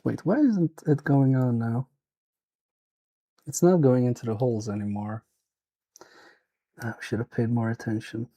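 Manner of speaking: usual speaking voice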